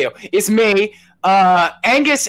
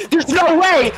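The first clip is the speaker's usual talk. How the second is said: excited announcer shout; 12 semitones higher, slower than usual. Acted. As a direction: shout the guest's name, thrilled. Announcing a surprise guest.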